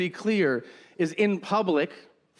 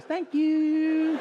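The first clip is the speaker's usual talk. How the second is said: high, drawn-out singsong politeness; super polite hostess; 10 semitones higher than usual. Retold or imitated. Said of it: "super polite".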